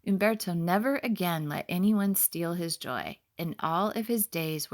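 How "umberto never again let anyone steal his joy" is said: ordinary delivery, nothing marked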